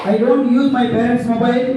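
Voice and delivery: slow, drawn-out chanted pledge; slow group recitation. Real, not acted. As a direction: recite the promise word by word. Class reciting a promise together.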